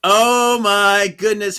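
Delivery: loud, high gasp; dramatic exclaim. Real, not acted. Host repeating the shocked cry, loud. Second reaction.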